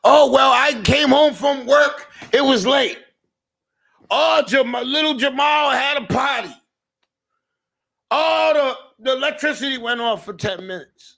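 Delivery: high, loud whiny excuse voice; mocking excuses. Mocking people making excuses for a dirty house, high loud whine. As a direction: ham up the lame excuses. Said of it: "as soon as you say something about your house".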